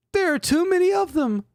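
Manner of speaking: extremely high squeaky falsetto; Jake voice. Acted. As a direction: whine it like a panicked kid.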